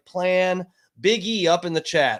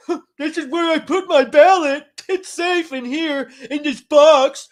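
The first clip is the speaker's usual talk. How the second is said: high, loud dopey voice, slowed on the middle line; mock naive voter; 10 semitones higher, 6 dB louder than usual. Streamer mocking voters who trust the drop box, dopey put-on voice. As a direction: play a clueless voter, hammed up.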